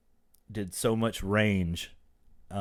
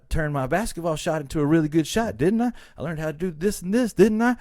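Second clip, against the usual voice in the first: worked-up, higher-pitched boast; defiant Will; 7 semitones higher than usual. Retold or imitated. Hurt son tells Uncle Phil he needs no dad, rising defiance. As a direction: insist you're fine without him, voice climbing on each "didn't I?". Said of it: "the scene where he starts saying".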